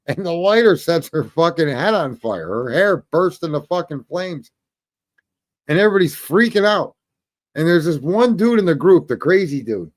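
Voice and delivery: ordinary talk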